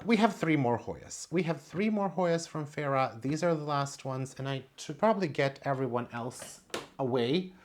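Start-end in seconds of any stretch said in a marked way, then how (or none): none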